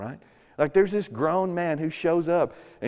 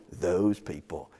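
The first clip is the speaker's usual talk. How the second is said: "those people" slowed and lowered, each word set apart; scare-quoted phrase; 6 semitones lower, slower than usual. Shown as a demonstration. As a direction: lean slowly on "those people" with quote marks in the voice.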